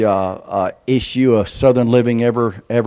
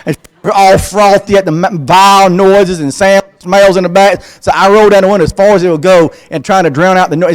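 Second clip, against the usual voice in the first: exasperated rant; 5 semitones higher, 6 dB louder than usual. Retold or imitated. Exasperated cat car story.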